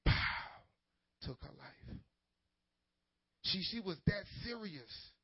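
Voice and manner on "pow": sudden loud "pow" like a shot; imitated gunshot. Preacher mimics the shot that kills the girl, imitated gunshot. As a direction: punch out a breathy burst of gunfire.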